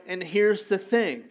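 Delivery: ordinary talk, nothing marked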